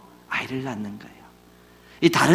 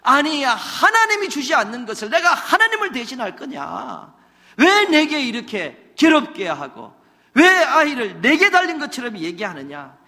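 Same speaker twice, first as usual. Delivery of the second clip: higher and louder, scolding hard; husband scolding his wife in anger; angry husband; 9 semitones higher, 6 dB louder than usual. Acted. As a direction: lose his temper at last. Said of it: "오늘은 화를 내는 거예요".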